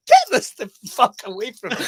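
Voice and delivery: laughing as he pretends to shove it off; mock outburst. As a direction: fling it away in jest. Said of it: "And it was like".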